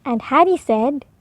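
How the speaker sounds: usual speaking voice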